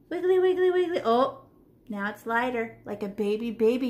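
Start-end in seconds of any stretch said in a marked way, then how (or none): 0.1-1.0 higher, louder playful wiggly, wiggly; sing-song wiggly. Shown as a demonstration. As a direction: bounce the words like the brush. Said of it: "and I go".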